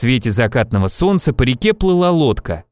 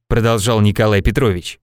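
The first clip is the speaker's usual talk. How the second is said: ordinary delivery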